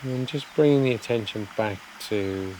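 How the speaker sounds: soft, unhurried meditation voice with long pauses; guided meditation. Real, not acted. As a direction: gently guide listeners to settle, phrase by phrase.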